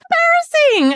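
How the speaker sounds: high, loud squeal of disgust; shrieked verdict. Real, not acted. Co-host shrieking at Lindsay's claim, scandalized. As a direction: fling the word out in a shriek. Outraged reaction.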